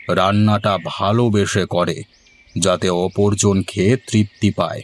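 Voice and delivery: ordinary talk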